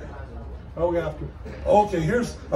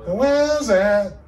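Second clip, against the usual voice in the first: parrot voice; 4 semitones higher, 3 dB louder, slower than usual. Acted. Parrot voice in joke.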